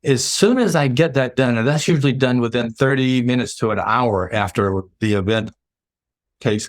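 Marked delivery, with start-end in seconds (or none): none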